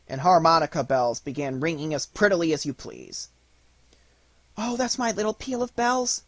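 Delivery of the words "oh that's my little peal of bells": put-on high-pitched little girl's exclamation; delighted cry. A narrator's reading. Marie hearing her own bells ring, high excited cry. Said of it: "cried Marie".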